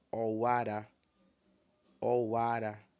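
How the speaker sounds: "Owara" kept low on every syllable; all low tone. Shown as a demonstration. Teacher models October for students, all low tones. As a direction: say "Owara" level and low throughout. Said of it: "Tudo em tom baixo".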